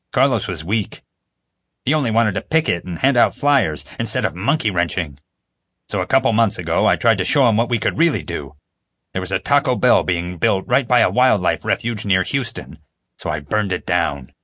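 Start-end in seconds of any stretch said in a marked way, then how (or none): none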